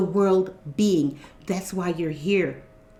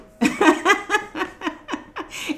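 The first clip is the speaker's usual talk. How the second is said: long high real laugh; 12 semitones higher than usual. Real, not acted. Laughs at viewer comments.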